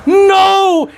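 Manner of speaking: high, loud cry of no; shouted refusal. Retold or imitated. Cellmate shouting his refusal at Tyson. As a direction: yell it out in mock panic. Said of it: "And I said".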